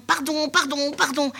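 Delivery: pardon aigu de fillette; petite fille pressée. Acted. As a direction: joue la petite qui se faufile. La fillette se fraie un passage. (fillette qui demande pardon, voix haute)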